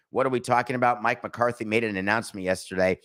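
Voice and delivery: ordinary talk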